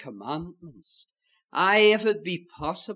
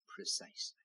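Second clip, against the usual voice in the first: breathy whispered repeat; whispered echo; 18 dB quieter than usual. Acted. Preacher repeating his answer to the congregation, whispered. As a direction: breathe the word out like a secret.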